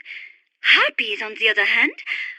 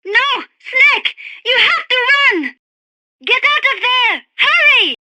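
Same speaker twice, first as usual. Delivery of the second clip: high, loud, urgent cries; panicked shouting; 7 semitones higher, 4 dB louder, slower than usual. Acted. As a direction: scream at him to flee. Screaming at Snake to flee.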